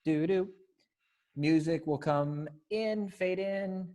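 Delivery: ordinary delivery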